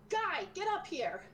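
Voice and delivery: high call downstairs, slow. Acted. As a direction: call down to the helper. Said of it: "leans down at the table and goes".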